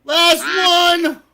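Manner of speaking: high, loud call-out; loud announcement. Real, not acted. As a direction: call it out to the whole crowd. Announces the last race.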